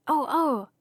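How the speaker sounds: excited little exclamation; suddenly excited. Acted. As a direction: pipe up with a sudden idea. Sudden eager idea about Earth.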